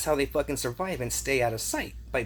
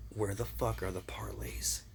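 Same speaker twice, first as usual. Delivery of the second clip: hissed question; 2 semitones lower, 6 dB quieter than usual. Acted. Advised retort, whispered.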